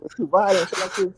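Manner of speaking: ordinary delivery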